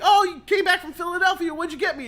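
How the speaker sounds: put-on high, quick kid's voice. Kids greeting parent home from Philadelphia. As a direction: chirp it like an eager child. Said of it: "your kids are like".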